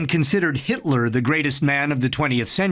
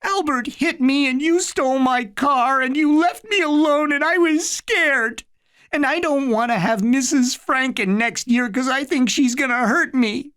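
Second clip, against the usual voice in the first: put-on snuffling child; 11 semitones higher than usual. A narrator's reading. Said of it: "He began to snuffle".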